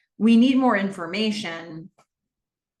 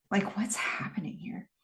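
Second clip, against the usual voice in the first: whispered puzzled reaction; whispered aside; 8 dB quieter than usual. Acted. Voicing an onlooker's silent puzzlement, whispered. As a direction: drop to a hushed, voiceless aside.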